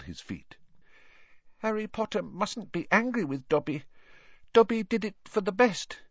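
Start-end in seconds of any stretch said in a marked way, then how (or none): 1.6-6.0 high, pleading little elf; squeaky anxious house elf. Acted. Dobby the elf speaking.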